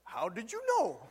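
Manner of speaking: surprised with a laugh in it; laughing surprise. Retold or imitated. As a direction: ask it half-laughing. Strangers' surprised answer.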